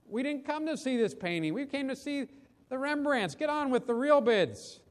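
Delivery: high, indignant heckle without much volume; lightly acted annoyed bidder; mild angry shout. A narrator's reading. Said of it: "Another voice shouted angrily".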